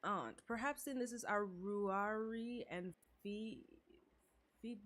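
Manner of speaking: slow, halting over names; stumbling slowly through Irish names; slow careful reading. Real, not acted. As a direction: sound out the hard names slowly. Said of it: "Hey, listen, I'm trying".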